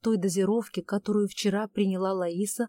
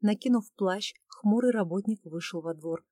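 plain reading voice

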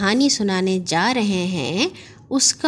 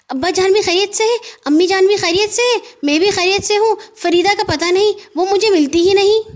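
put-on high, fast boyish rattle; young boy Gaggu; 11 semitones higher, 4 dB louder than usual. Gaggu hurriedly answers his uncle in a high boyish rattle. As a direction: reel off the answers in a hurry.

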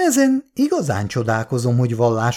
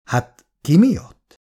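slow, higher uneasy question; uncomfortable; 4 semitones higher, 4 dB louder, slower than usual. A narrator's reading. Irén hearing an unwelcome suggestion, uneasy. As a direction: ask as if unpleasantly touched. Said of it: "kellemetlenül érinti ez a feltételezés".

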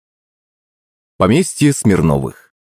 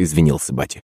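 ordinary delivery, nothing marked

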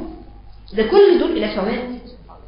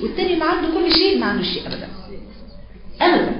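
higher, faster impassioned preaching; emphatic rise; 8 semitones higher, faster than usual. Acted. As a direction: lift the voice high and push the point. Emphatic climax.

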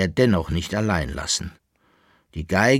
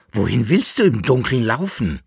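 raised, urgent question; shouted; 4 semitones higher, 5 dB louder than usual. A narrator's reading. Jäckele-Narr calls after his departing companion, voice raised. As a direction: call after him, moderately raised. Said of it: "schrie der Jäckele-Narr".

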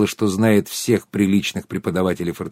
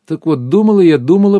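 lively, raised lead-in to a tale; animated storytelling; 6 semitones higher, 6 dB louder than usual. A narrator's reading. Tatiana resumes her story for the nodding ladies, animated. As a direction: launch in higher and louder.

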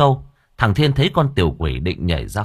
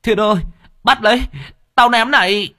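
high, louder call; shouting across; 13 semitones higher, 4 dB louder than usual. Acted. Old man shouts to Thiên as he throws the bowl, loud.

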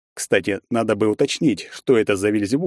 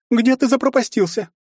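high-pitched woman calling her son; mother's voice; 3 dB louder than usual. A narrator's reading. Mother asking her son where he vanished, high-pitched calling.

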